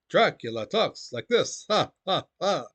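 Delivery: Hungarian-accented Dracula line ending in a mock vampire laugh; a playful Hungarian-accent vampire with a theatrical ha ha ha; Bela Lugosi's Dracula accent. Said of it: "he had that thick Hungarian accent".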